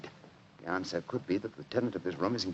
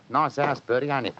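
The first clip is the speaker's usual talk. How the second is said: Cockney workman voice, a bit higher; Cockney disguise; 4 semitones higher than usual. Acted. Disguised Holmes chats to Watson, Cockney accent. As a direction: keep up the Cockney act. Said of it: "My Cockney accent".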